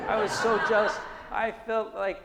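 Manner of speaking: laughing loudly through the words. Real, not acted. Laughing over his jealousy.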